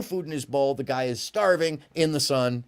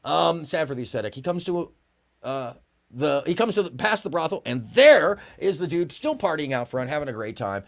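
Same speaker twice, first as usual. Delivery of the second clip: ordinary talk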